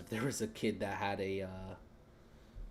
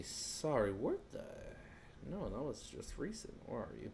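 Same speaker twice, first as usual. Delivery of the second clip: usual speaking voice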